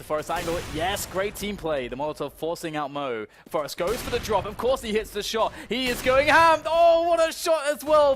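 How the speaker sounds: screaming hyped play-by-play; esports caster. Real, not acted. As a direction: go wild on the big multi-kill. Live play-by-play hype.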